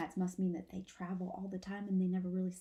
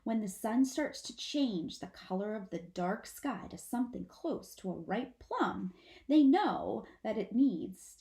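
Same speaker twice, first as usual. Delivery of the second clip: usual speaking voice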